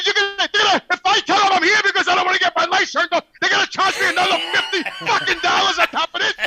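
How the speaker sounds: hammed-up outrage at the extra charge; retold indignant protest. Retelling his protest at the caller.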